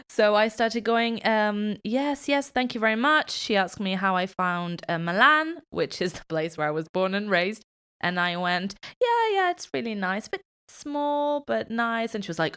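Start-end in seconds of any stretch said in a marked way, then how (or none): 1.8-3.3 lightly put-on foreigner, pitched higher; pretending to be foreign. Fooling a fellow Italian on the plane, pretending to be foreign. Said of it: "I pretended to be foreign". 9.0-12.2 high-pitched, put-on polite foreigner; pretending to be foreign. Faking a visitor's view of hometown Milan, pretending to be foreign. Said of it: "I pretended to be foreign".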